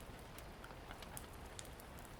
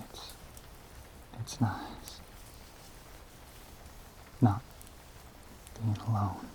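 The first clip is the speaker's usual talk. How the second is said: tender gratitude; 10 dB quieter, much slower than usual. Acted. Lonely monster thanking the woman for company, tenderly. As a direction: let it fade gently with long pauses.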